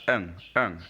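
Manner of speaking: "ung" as a short French nasal "in"; "ung" repeated as the nasal sound of IN; nasal vowel demo. Shown as a demonstration. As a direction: sound out "ung" through the nose. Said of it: "it's pronounced ung".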